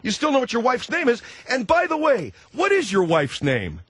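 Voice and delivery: high, insistent, talking over the caller; worked-up, incredulous badgering; exasperated arguing. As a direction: hammer away, voice pitched right up. Host argues with evasive caller.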